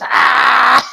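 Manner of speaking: high, loud hammed-up scream; mock monster yell. Tyler turning into Barbas, yelling. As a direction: roar like a transforming demon.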